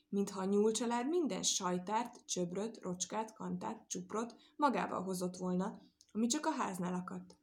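ordinary delivery, nothing marked

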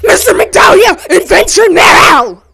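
high, loud, urgent telling; frantic excitement. Acted. Player frantically narrating Danny climbing aboard, high loud excitement. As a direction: race through it breathless with urgency.

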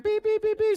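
high, loud electronic beeps; imitated detector beeps. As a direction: sound like a detector going off. Detector going off over perfect family.

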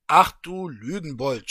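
loud, scolding official. Acted. Official scolds the traveller as a liar, loud. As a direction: scold him as a liar, loudly. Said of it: "schimpfte der Amtsvorsteher".

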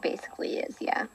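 whispering. Acted. Sudden whispering.

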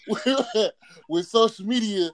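ordinary delivery

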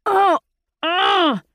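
high, loud cry of pain. Acted. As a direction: cry out as if struck. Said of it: "Chapman cried out".

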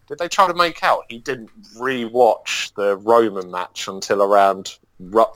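ordinary talk, nothing marked

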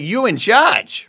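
high, loud, drawn-out imitated customer; emphatic repeat. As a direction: stretch it out loudly, pitched high. Customer repeating himself louder.

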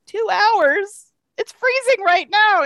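high, loud outraged question; indignant disbelief. Retold or imitated. Andrea to shelter line, outraged disbelief. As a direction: protest the wait. Indignant at two hour wait.

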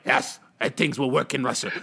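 put-on Russian accent. Russian politician selling it back home, Russian accent. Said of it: "Russian be like".

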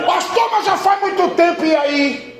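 loud, high, stretched preaching shout; full-force preaching. Acted. Pastor urging the church never to stop praying, full-force shouting. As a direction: drive every word into the congregation.

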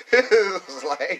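slow words swallowed by laughter; laughing. Real, not acted. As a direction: laugh hard while talking. Laughing hard at the joke.

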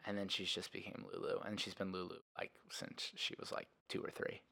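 fading to a breathy whisper; trailing whisper. Real, not acted. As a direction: let it drop to a whisper.

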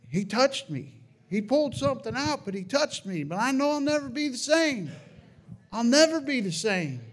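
rising pitch, voice swelling with emotion; emotional testimony. Real, not acted. Testifying that Jesus touched him, emotional and rising. Testimony climax, overwhelmed by faith.

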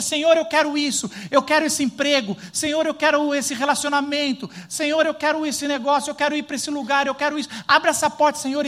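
mock-pleading prayer, high and loud; pleading believer. Pastor imitating a believer pleading with God, mock-begging. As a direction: beg God like a child wanting everything.

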